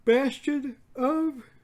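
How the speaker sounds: high, loud, stretched words through a yawn; talking through a yawn. Real, not acted. Reading the card name mid-yawn, stretched and loud. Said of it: "Excuse me for my yawn".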